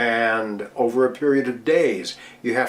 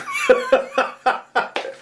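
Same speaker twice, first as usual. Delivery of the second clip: laughing. Real, not acted. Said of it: "now I'm choked up".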